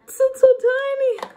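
very high, loud squeal; high-pitched, thrilled cooing; squealed delight. Real, not acted. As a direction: gush over something cute. Delight at tiny product.

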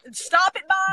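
playful loud scolding; mock scolding, loud; loud teasing stop it. Real, not acted. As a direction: cut her off, laughing loudly. Teasing friend about Bob.

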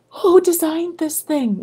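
high, annoyed question; exasperated. Retold or imitated. Quoting her past annoyance, high and exasperated. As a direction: ask it fed up.

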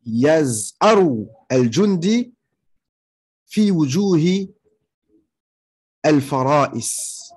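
very slow, word-by-word Arabic example; slow dictation. Shown as a demonstration. Teacher giving students an Arabic example about a roaring soldier, slow dictation. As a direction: draw out each word with long pauses between phrases.

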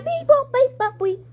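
high, fast robot beeping; robot beeps. Pretend. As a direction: beep like a toy robot. Robot noises.

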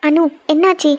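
normal talking voice

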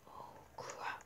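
hushed whisper. Real, not acted. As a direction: breathe it out, scared of being heard. Whispered while sneaking.

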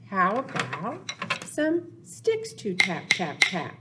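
ordinary talk, nothing marked

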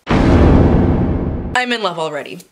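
slow, loud, lovestruck swoon. Real, not acted. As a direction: melt over the book, dragging it out. Swooning reaction to blurb.

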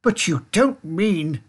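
high, slow disbelief; alarmed shout. A narrator's reading. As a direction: protest in dawning alarm. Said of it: "shouted Grandpa Joe".

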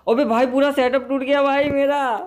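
high, loud wail of dismay; shouted, high-pitched lament; dismayed outcry. Real, not acted. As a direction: cry out in alarm at the wreckage. His game setup just broke.

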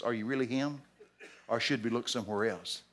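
lightly acted low, soft doubting question; subdued doubt. John in prison doubting Jesus, quiet and low. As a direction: ask it softly, certainty gone.

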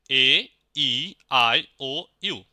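loud, separated A, E, I, O, U as in English; English vowel names. Shown as a demonstration. Teacher reciting vowels like English ones, English letter names. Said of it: "ইংরেজি ভাওয়ালের মতোই same".

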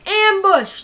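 loud urgent battle cry; shouted warning. Acted. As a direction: yell it to warn the squad. Soldier warns of ambush.

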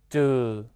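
"zhe" drawn out with a sinking second tone; second-tone demonstration. Shown as a demonstration. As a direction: model "zhe" twice, long and falling. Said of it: "加二声".

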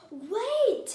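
high, loud, urgent shout; urgent call. A narrator's reading. Mister Ruggers trying to stop Amelia Bedelia, urgent call.

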